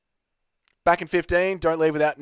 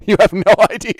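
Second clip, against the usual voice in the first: laughing; 8 semitones higher, 8 dB louder, faster than usual. Real, not acted. Laughing at his own swearing.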